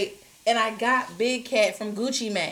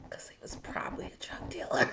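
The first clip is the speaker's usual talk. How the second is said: whisper; 13 dB quieter than usual. Real, not acted. Gossipy aside about the driver.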